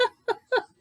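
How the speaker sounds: short high laugh. Acted. Teacher reading Bessie laughing off the doubters, short high laugh. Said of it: "But this woman laughed".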